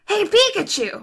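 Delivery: high, animated cartoon boy; Ash voice. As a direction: greet him like an eager anime hero. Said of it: "he's more animated".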